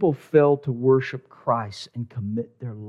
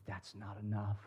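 hushed; 4 semitones lower, 21 dB quieter than usual. Acted. Jesus's quiet reply to Nicodemus.

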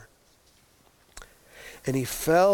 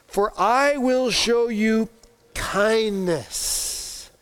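higher, slow, heartfelt vow of kindness; tender promise; 5 semitones higher, much slower than usual. Acted. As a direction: linger on kindness with warmth.

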